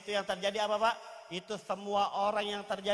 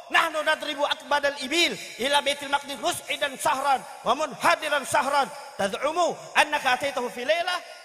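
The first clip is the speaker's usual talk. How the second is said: loud, raised-pitch argumentative harangue; Mut'im bin Adi shouting; 3 semitones higher, 4 dB louder than usual. Acted. Mut'im argues the camel journey takes two months, shouting. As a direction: argue it forcefully at the top of the voice. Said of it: "Mut'im bin Adi teriak".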